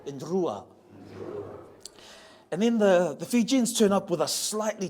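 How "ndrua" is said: "Ndrua" starting with N before the D; "Ndrua" with ND and a rolled R. Shown as a demonstration. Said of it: "D is an N D sound and you roll the R's".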